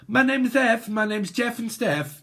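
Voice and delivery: high, silly introducing voice; mock introduction. Reader mocks a rhyming third party, silly introduction.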